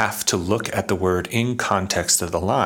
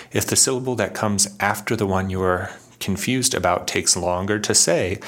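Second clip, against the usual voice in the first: ordinary talk, nothing marked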